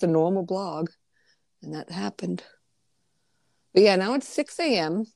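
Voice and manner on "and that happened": whispered aside. Real, not acted. Aside about the scandal.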